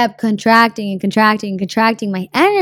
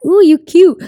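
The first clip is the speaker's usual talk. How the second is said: high, loud flirty squeal; flirty ooh; 8 semitones higher, 7 dB louder than usual. Retold or imitated. Meeting someone attractive, with a loud high flirty coo. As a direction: coo it at someone you fancy. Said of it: "you start tingling and you're like".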